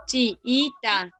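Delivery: "Chiita" chopped as chi, i, ta; syllable-by-syllable demo. Shown as a demonstration. Teacher checks a division with pupils, "Chiita" split chi-i-ta. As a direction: separate the two i's in "Chiita" with little gaps.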